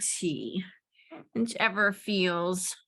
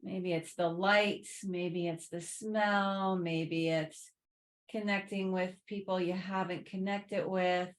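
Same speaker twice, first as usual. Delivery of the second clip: soft, unhurried meditation voice, settling lower; guided reflection; 3 semitones lower, 9 dB quieter than usual. Real, not acted. Yoga teacher prompting resting students to reflect on the holidays, soft guided reflection. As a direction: offer each thought quietly, leaving room between them.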